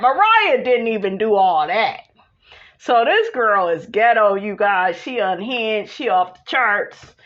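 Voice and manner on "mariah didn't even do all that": normal talking voice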